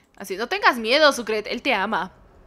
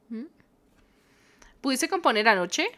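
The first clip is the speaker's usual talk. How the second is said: normal talking voice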